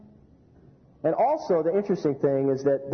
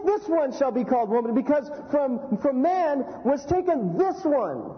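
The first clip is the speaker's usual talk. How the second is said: higher, awestruck and halting; hammed-up wonder, slowing at the end; excited Adam; 7 semitones higher than usual. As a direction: voice the first man overwhelmed by his bride. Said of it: "He says".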